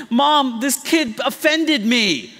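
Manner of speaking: loud, high, drawn-out whine to mom; a child tattling, hammed up; mock tattling kid. Imagined child complaining to mom.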